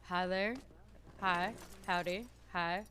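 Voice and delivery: low, level greetings without lilt; flat greeting. Shown as a demonstration. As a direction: keep the pitch steady. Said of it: "stop myself from doing the up down".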